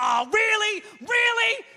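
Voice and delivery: high, loud mock outrage; exasperated shout. Owner yelling at his crashed computer, high exasperated shout. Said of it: "You'd be like".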